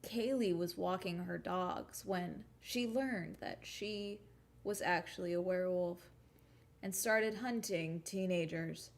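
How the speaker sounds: slow, measured storytelling with long pauses; serious narrator imitation. Shown as a demonstration. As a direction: mimic a put-on serious YouTube narrator, drawing out the phrases. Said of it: "let me do a different one".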